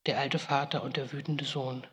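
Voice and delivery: usual speaking voice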